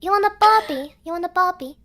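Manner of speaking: loud mock Australian "You want a Barbie?"; hammed-up Australian accent. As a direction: call out like an Aussie at a barbecue. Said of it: "You're from down under".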